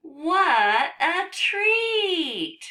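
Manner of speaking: high, loud and very drawn-out; delighted exclamation. Acted. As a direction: stretch it out with cartoonish delight. Book character exclaims over chocolate.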